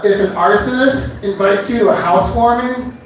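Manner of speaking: ordinary talk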